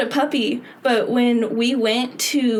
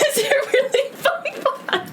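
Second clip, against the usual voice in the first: said through laughter, pitched up; giggling, pitched up; laughing; 14 semitones higher than usual. Retold or imitated. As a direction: laugh out the question. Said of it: "I said".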